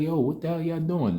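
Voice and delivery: high, stunned demand; shocked outrage. Retold or imitated. Family retelling their demand to the nurses, shocked disbelief. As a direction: call out in disbelief. Retold outrage at hospital staff.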